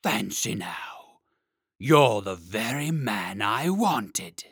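very slow, heavy dragon. Acted. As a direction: drag each word out like a great beast.

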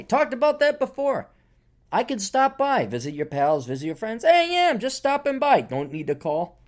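high casual just-passing-through tone; breezy drifter. Retold or imitated. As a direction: toss it off like a wandering free spirit. Voicing his carefree drop-in self.

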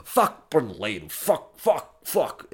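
lightly voiced drunk swearing; drunk ranting. Drunk man cursing at café tables, lightly voiced. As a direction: slur a hint of the drunk's mock English. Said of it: "então ele dizia".

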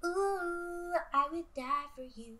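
high, drawn-out coo; cutesy cooing. Real, not acted. Podcaster adores the googly-eyed teddy, cooing. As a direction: coo as if to something tiny and adorable.

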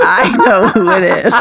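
giggling through the words; laughing. Real, not acted. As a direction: laugh as you say it. Laughing while answering.

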